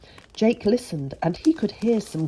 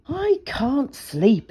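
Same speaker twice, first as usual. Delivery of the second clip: put-on sleepy child; 8 semitones higher, much slower than usual. Child Jake complains he can't sleep.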